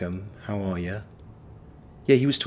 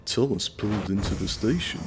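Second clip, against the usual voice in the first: [name] narrating; 3 dB quieter than usual. Retold or imitated. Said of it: "[name] will forever be the voice of Thomas".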